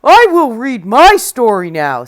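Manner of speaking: loud, firm giant; booming giant. Acted. Giant insisting on his story, booming. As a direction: announce it like a giant. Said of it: "said the giant".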